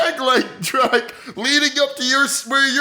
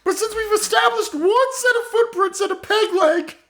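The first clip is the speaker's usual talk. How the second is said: put-on higher, rambling old woman owning up with a laugh; old lady confessing; 5 semitones higher than usual. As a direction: confess as the doddering grandma, pitched up. Grandma character confesses to murder.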